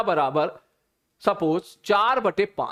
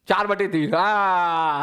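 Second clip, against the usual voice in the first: normal talking voice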